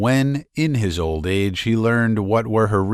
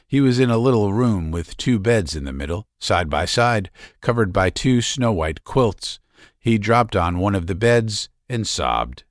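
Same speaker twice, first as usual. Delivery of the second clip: ordinary delivery, nothing marked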